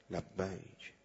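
low, very soft echo; tender, fading response from above; God's gentle answer. Retold or imitated. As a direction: let the reply sink to a hush.

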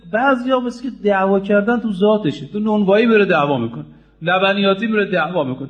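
ordinary delivery

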